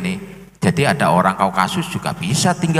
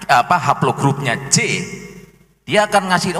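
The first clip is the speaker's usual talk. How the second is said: ordinary delivery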